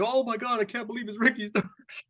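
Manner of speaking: high, amazed announcer shriek; mock announcer. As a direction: ham up a shocked ringside announcer. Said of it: "the announcer's like".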